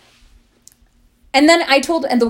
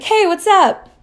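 high, loud put-on greeting; mock awkward approach; 9 semitones higher, 4 dB louder, slower than usual. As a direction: fake an overeager cold message. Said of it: "That would be really weird".

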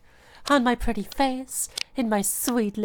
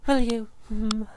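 ordinary talk, nothing marked

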